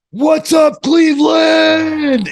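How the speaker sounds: big high drawn-out arena shout; wrestling promo shout. Retold or imitated. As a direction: hype up the crowd at full voice. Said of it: "he goes".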